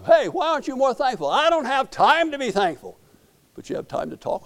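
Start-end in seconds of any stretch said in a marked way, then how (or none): 0.0-1.3 raised high challenging call. Retold or imitated. Bystander calls to cursing sailor, loud and high. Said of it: "and somebody says". 1.3-3.0 loud, high mock sailor snap-back; gruff sailor retort. Cursing sailor snaps back at bystander, loud mock voice. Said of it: "cussing like a drunk sailor".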